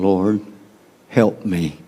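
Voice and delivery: soft, low plea for help; quiet plea. Retold or imitated. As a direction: beg quietly from the floor at his feet. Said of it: "knelt before him saying".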